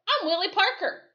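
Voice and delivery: very high, loud jeering name; mocking voice. Man in the crowd mocking the sheriff, very high and loud. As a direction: squeak it out to mock him.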